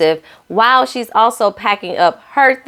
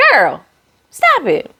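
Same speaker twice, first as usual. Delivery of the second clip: playful scoff; sassy dismissal; 3 semitones higher, slower than usual. Real, not acted. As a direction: wave it off with disbelief. Scoffing at their finances talk.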